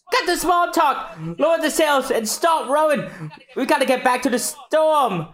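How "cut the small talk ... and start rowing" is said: bossy shouted order. Acted. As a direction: bark the orders like the navigator. Voicing Nami giving orders.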